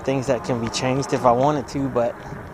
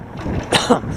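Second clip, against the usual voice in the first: sudden hacking cough; 3 semitones higher than usual. Real, not acted. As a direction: cough on a swallowed bug. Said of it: "I swallowed a bug".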